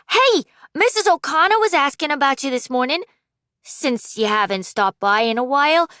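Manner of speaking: a hint of a chatty younger sibling; lightly voiced kid sibling. Kid sibling gossiping to Wes about the neighbours, lightly voiced.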